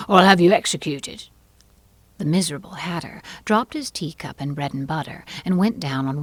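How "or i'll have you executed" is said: loud royal threat; threatening king. Acted. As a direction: threaten the witness with full authority.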